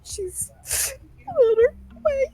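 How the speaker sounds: sobbing. Acted. Widow asked who she can stay with, sobbing out her answer. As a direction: sob it out in a high, halting voice. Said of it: "sobs out".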